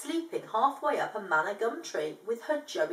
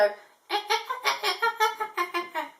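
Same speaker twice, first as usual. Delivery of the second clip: high chattering kookaburra cackle; bad kookaburra impression; 11 semitones higher than usual. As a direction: chatter like a monkey-ish bird. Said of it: "my really bad kookaburra impression".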